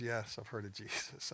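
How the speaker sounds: mildly exasperated retelling; half eye roll. Retold or imitated. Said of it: "gave me a half an eye roll".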